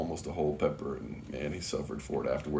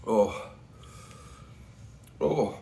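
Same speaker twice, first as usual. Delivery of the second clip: ordinary talk, nothing marked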